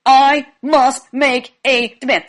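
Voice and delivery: stilted, over-emphatic recital; mustered-up demand. Acted. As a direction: muster up fake authority, each word separate. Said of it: "So repeat that".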